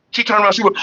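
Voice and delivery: normal talking voice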